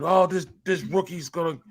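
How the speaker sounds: mild imitation of a coworker ranting; half-hearted impression of an annoyed yell; retold yelling. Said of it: "he started yelling".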